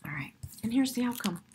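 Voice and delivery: quick hushed whisper; whispered reveal. Real, not acted. Whispering the outcome card to the viewer. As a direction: breathe it out like a secret.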